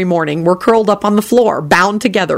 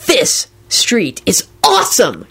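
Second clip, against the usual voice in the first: slow, drawn-out dude amazement; ecstatic shout; slower than usual. A narrator's reading. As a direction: stretch each word in ecstasy. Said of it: "Kenny shouted ecstatically".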